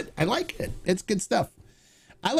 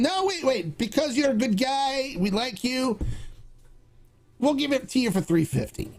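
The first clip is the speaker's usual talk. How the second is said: wheedling shopkeeper; 7 semitones higher than usual. Retold or imitated. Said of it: "And then you start looking".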